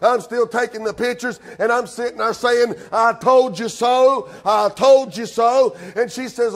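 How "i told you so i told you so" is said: slow, singsong told-you-so; a lightly acted slow gloat. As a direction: drag it out to rub it in. Gloating at wife in mud.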